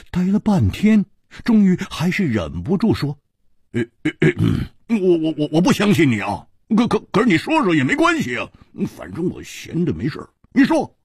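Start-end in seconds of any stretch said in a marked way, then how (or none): 3.7-11.0 stuttering, feigned nonchalance; flustered stammer. Acted. Hu Tiehua stammering, pretending not to care. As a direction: trip over words hiding eagerness.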